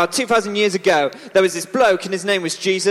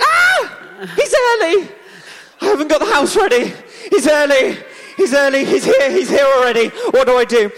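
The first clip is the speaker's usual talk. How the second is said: high, loud frantic shrieking in a flap; hammed-up panic, voice cracking high; panicked scream; 7 semitones higher, 4 dB louder than usual. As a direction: shriek it out like a host caught with a messy house. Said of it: "and Martha screamed".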